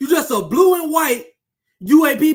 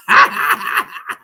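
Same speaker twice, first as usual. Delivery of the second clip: breathy cackle; 6 semitones higher than usual. Real, not acted. Ending rant dismissively.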